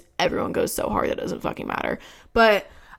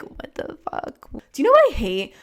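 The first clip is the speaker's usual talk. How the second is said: judgmental friend; 5 semitones higher than usual. Retold or imitated. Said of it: "gonna be like".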